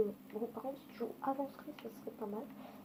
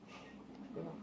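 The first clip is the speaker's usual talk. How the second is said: whispered joke; 6 semitones lower, 13 dB quieter, much slower than usual. Real, not acted. Whispered disclaimer.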